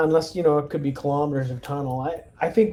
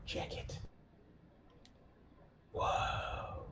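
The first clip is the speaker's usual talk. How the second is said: hushed awed whisper; 19 dB quieter, much slower than usual. Real, not acted. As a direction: breathe it out in quiet amazement. Awed reaction to the underground city.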